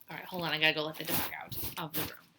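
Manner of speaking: usual speaking voice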